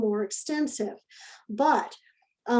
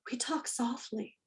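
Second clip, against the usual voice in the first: a soft unvoiced whisper; 11 dB quieter than usual. Shown as a demonstration. Teacher whispering the rule to a noisy class. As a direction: breathe it to calm the room. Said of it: "You could even whisper it".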